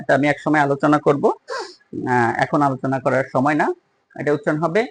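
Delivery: normal talking voice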